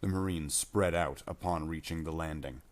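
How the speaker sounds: plain reading voice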